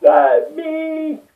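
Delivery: high, loud protest; mock outrage. Refusing the bad news, a high, loud indignant protest. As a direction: rail against fate, half joking. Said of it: "I'm like".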